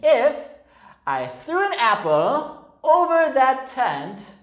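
high, slow taunting schoolboy; teasing boy. A narrator's reading. Boy Stephen taunting the kangaroo, high and slow. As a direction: dare him with a cheeky sing-song.

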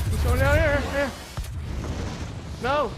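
loud yelling. Real, not acted. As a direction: yell the call, then cry out no. Shouting a callout, then a cry.

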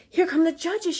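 high, loud shout. Acted. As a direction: call out excitedly. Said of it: "shouted Jill".

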